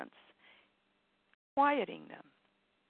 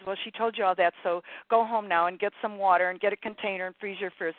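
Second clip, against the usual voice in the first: lightly voiced bossy ego, louder; 6 dB louder, faster than usual. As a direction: rattle off orders as the nagging inner voice. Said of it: "the ego voice that says".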